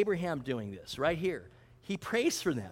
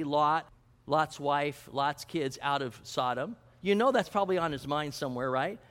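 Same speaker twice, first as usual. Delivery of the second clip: ordinary talk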